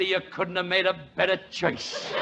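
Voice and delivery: ordinary talk, nothing marked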